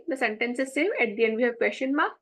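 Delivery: normal talking voice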